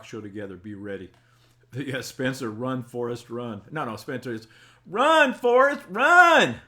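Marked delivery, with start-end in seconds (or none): 4.9-6.6 loud high drawn-out yell; urgent calling out. Shown as a demonstration. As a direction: holler it slowly at full volume, way up high. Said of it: "That's the way it's supposed to be done".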